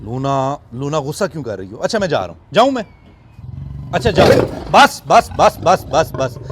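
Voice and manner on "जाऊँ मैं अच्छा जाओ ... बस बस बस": loud, higher "बस, बस" as the lioness charges; loud startled calming of a charging lioness; startled loud backing off. Real, not acted. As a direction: shout her down while stepping back. Lioness snarls, he backs off.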